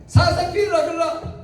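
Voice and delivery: high, forceful command; God commanding. Retold or imitated. God commanding the sister to beg Moses. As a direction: order her to beg, sharply. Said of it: "그러니까 하나님이".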